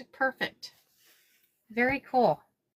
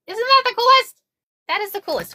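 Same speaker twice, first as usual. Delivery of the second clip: high, loud delighted gush; thrilled; 11 semitones higher, 5 dB louder, faster than usual. Real, not acted. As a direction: gush over the finished bag. Thrilled showing the finished bag.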